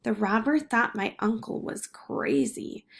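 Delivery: ordinary delivery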